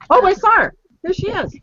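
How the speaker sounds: excited greeting. Real, not acted. Guest finally appears on stream.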